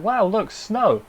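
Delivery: playful imitation of awed boys; mock wonder. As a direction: draw it out like awestruck boys. Boys marvel at falling snow.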